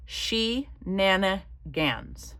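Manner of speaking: slowly stretched, syllable by syllable; drawn-out word. Shown as a demonstration. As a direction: draw out each syllable of She-nana-gans. Playful drawn-out repeat of shenanigans.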